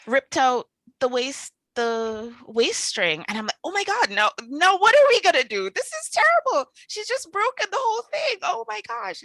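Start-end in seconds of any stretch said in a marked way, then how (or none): 3.6-9.2 much higher and louder, frantic panic; panicked. Retold or imitated. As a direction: freak out over the ruined pants. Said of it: "I'm panicking".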